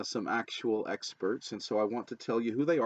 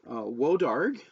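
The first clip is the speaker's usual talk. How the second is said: normal talking voice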